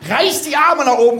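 ordinary talk

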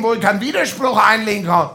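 ordinary talk, nothing marked

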